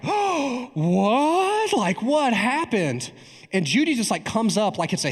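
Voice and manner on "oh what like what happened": high, drawn-out mock astonishment; stretched, squeaky "what" in pretend shock; shocked congregation. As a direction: imitate a scandalized crowd's gasped disbelief. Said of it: "there's this audible gasp".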